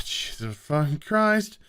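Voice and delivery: normal talking voice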